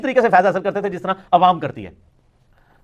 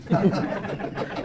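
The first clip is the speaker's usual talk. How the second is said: laugh; 2 semitones lower, 10 dB quieter than usual. Real, not acted. Mocking the opponents' wordplay.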